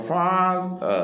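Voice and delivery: "father" split, first syllable high and drawn out; stressed first syllable. Shown as a demonstration. Teacher breaking "father" in two for students, first syllable strongly stressed. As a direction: hit "fa" high and long, drop the "ther". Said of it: "father ആണ് stress ചെയ്യേണ്ടത്".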